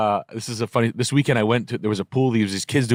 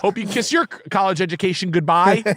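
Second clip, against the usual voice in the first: higher, gloating mock threat; mock teacher dad; 7 semitones higher than usual. Petty dad threatening the flipping kids, higher gloating mock. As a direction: taunt the kids like a smug dad.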